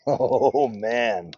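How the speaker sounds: ordinary delivery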